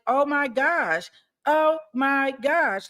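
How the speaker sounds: high, slow dismayed gasp; drawn-out horrified oh my gosh; shocked. Real, not acted. Shocked at the killing.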